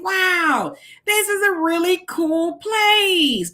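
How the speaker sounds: high, drawn-out wowed exclamation; amazed delight. Retold or imitated. Host recalls arriving in Japan, acting out amazement.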